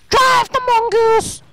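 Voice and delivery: high, loud, stretched-out yell; excited shout. Real, not acted. Gamer eager to drive the vehicle, excited shout. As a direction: yell it out like a battle order.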